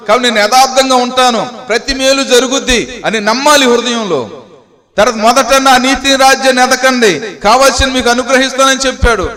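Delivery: high-pitched, insistent declarations of faith; fervent preaching. Real, not acted. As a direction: drive each confession home from the pulpit. (ఆవేశపూరిత ప్రసంగ స్వరం)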